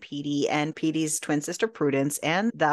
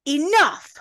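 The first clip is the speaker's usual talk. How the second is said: loud, high outburst; "enough" as an explosive order; commanding shout; 8 semitones higher, 7 dB louder than usual. Retold or imitated. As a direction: cut them all off with a sudden shout. George cuts off the argument.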